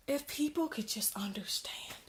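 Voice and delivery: a sudden unvoiced whisper; whispered aside. Real, not acted. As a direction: drop to a secret hush. Whispered aside mid rant.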